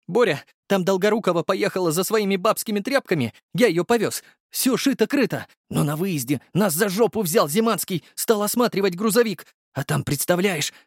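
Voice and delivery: high, gossipy driver voice; the driver Igor bringing Borya the news, high and chatty; chatty young driver. Acted. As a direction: rattle it off, pitched high.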